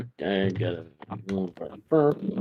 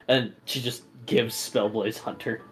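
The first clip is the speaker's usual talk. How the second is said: ordinary delivery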